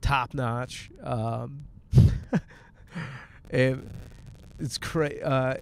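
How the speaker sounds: ordinary talk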